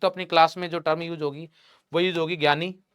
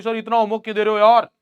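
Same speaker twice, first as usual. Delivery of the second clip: quick, louder mock protest; mock student complaint; 5 semitones higher, 6 dB louder, faster than usual. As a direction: imitate an exasperated student rushing out a complaint. Voicing students protesting about homework.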